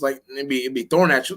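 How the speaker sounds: ordinary talk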